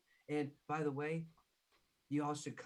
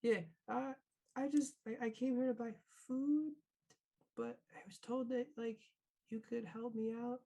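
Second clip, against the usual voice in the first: higher, halting, stammering voice; nervous Mary; 9 semitones higher than usual. Acted. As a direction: stumble through it like a scared kid. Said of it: "Mary kinda nervous goes".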